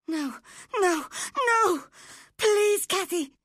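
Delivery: very high, loud, frantic wail; wild screaming. A narrator's reading. Linton begs Cathy to come along, screaming wildly. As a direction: scream it in desperate, tearful panic. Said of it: "screamed Linton wildly".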